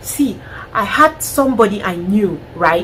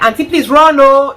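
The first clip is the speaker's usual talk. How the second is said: higher, louder urgent warning; urgent plea; 5 semitones higher, 7 dB louder than usual. Pretend. Urging an abused woman to flee, urgent plea. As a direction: beg her to run. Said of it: "I'm like".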